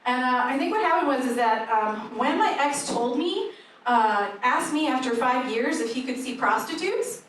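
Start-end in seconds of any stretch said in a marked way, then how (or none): none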